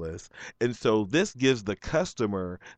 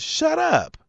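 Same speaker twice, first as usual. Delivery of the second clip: high, sharp mock outburst; mock snap. Telling off the nosy lady, sharp and high. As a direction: bark it up high in retold anger.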